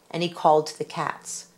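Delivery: usual speaking voice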